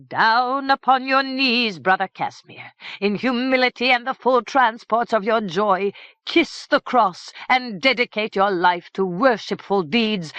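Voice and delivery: fervent preaching. A narrator's reading. Priest commands the kneeling king, preaching loud and high. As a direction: proclaim like a triumphant cleric.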